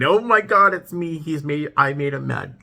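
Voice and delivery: high, loud burst of fake panic, then settling; mock panicked [name]. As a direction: play a viewer suddenly afraid she caused this. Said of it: "I know you're thinking".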